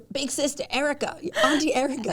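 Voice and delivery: big-sister pep talk, teasing; playful hype. Pretend. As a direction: tease herself as Auntie Erica.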